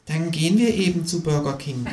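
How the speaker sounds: high kangaroo voice, held down in volume; high-pitched resolute figure; determined kangaroo. A narrator's reading. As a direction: plot the next move in a high, contained voice. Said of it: "sagt es".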